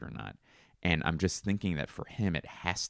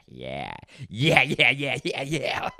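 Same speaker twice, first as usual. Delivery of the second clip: high, louder yeah-yeah laugh; silly fake laugh; 8 semitones higher, 5 dB louder, slower than usual. Mocking his own odd laugh for listeners, silly yeah laugh. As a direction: laugh in barking yeahs. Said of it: "What if that's how I laughed".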